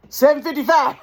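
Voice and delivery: high, loud mimicked cashier; cashier calling total. Leaning cashier ringing up a customer, mock announcement. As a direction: call the price out high and loud.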